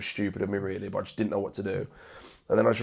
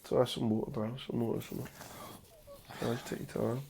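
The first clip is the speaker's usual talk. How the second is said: softer, hushed comfort to a crying friend; gentle reassurance; 2 semitones higher, 10 dB quieter than usual. Real, not acted. Host softly calming his guest who is close to tears, hushed and reassuring. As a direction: soothe him quietly, no rush.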